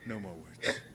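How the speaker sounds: hushed, firm whisper; whispered command. Acted. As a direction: hiss it to end the talk. Percival cuts him off.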